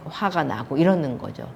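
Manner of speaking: ordinary talk, nothing marked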